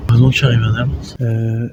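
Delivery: ordinary delivery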